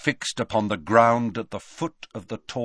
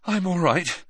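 slightly raised, winded reply; panting; 7 semitones higher than usual. A narrator's reading. Harry reassuring Hagrid after collapsing, panting. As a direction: answer as if short of breath. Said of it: "panted Harry".

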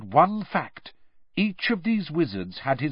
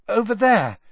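a touch shaky, louder and higher; shakily; 8 semitones higher, 6 dB louder than usual. A narrator's reading. Hermione answering Mr Weasley, mildly shaky. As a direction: point it out nervously. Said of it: "said Hermione shakily".

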